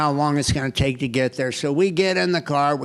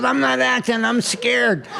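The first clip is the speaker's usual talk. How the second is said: mock scared wife; 8 semitones higher than usual. Wife protesting to speeding husband, mock frightened and higher. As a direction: play the frightened wife, lightly mocked.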